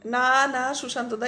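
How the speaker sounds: ordinary delivery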